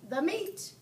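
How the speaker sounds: high echoing shout; crew shout. A narrator's reading. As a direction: shout it back. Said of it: "we all shouted".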